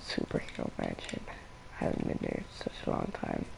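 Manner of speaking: very low, gravelly put-on voice; low croaking joke voice; weird low croak. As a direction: growl it out from the throat. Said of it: "I should probably stop talking like this".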